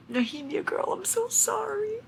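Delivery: a pained high apology; tender high lament. Real, not acted. Reader apologizing to a dead character, high and aching.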